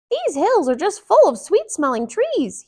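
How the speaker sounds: put-on high, bright-eyed young hero voice; high eager woodcutter voice. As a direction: voice Mickey as a keen young woodcutter.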